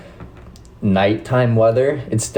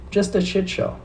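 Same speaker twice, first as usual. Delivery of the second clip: much higher, squeaky mocking echo; 12 semitones higher than usual. Repeats the phrase in a put-on voice.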